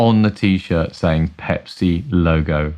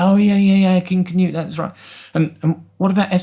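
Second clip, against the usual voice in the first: imitating his mum; 12 semitones higher than usual. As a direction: play his mum catching on at last. Son voicing his mum.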